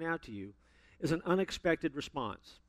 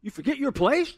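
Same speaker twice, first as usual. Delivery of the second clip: high-pitched, hammed-up indignation; mock outraged son putting mother down; rude rebuke of mother; 10 semitones higher, 5 dB louder than usual. Shown as a demonstration. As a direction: scold her in an exaggerated, annoyed voice. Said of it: "he's looking back at his mom and going".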